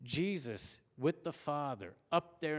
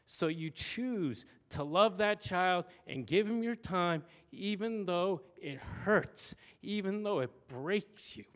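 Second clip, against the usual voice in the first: teary-eyed; 6 semitones higher, 4 dB louder than usual. Real, not acted. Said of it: "I get teary-eyed about this".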